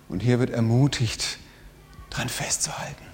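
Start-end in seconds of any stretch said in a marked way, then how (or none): 2.1-3.1 much softer whisper to close; hushed ending. Acted. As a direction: trail off into a whisper. Whispered closing words.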